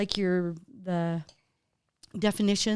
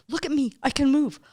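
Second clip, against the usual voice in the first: excited dog voice; 7 semitones higher, 7 dB louder than usual. Retold or imitated. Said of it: "he jumps around like".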